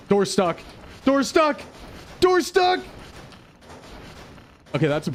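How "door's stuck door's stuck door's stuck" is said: building panic. Real, not acted. Streamer finds the door jammed, panic rising. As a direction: go from worry to real alarm.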